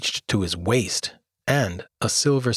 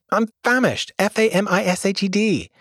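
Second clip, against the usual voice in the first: spelling bee voice; 11 semitones higher than usual. Acted.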